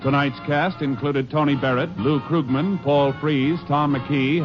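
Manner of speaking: ordinary delivery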